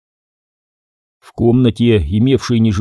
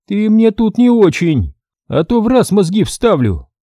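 суровый женский голос выше и громче; грубо осади наглеца; сурово; 9 semitones higher than usual. Acted. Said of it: "сурово ответила брюнетка". (брюнетка сурово осаживает мастера высоким голосом)

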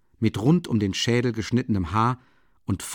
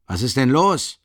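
louder, heated repeat of the question; agitated; 3 semitones higher, 6 dB louder than usual. A narrator's reading. Porfiri repeating his question toward the door, agitated. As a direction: demand an answer, worked up. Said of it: "wiederholte Porfiri Petrowitsch aufgebracht".

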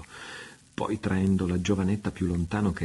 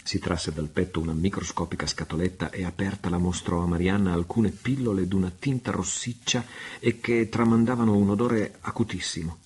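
ordinary delivery, nothing marked

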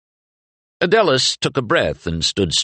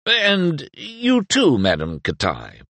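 palpable afterthought; 9 semitones higher, slower than usual. Acted. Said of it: "As an afterthought to the afterthought".

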